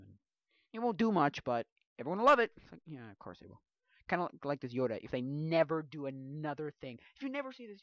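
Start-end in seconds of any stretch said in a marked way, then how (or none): none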